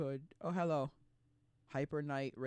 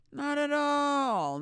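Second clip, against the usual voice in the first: much higher, slow, drawn out; mock high drawn-out denial; 13 semitones higher, 6 dB louder, much slower than usual. Answering her own question, mock high and slow. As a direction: stretch it up high like a mocking singsong.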